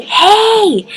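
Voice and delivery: high, loud drawn-out hey; calling out. Retold or imitated. Vlogger retelling how she hailed Keaton, calling out. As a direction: hail him with a high yell. Retold call to get his attention.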